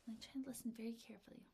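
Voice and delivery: hushed, quick whisper; intent listening. Acted. Teacher acting out someone listening very carefully, hushed whisper.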